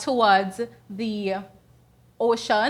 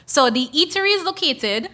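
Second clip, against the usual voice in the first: ordinary talk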